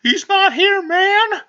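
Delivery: very high, squealing plea; mock panicked plea. Pleading with fans at his door, mock panic. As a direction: beg in a hammed-up falsetto panic. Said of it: "And I'll be like".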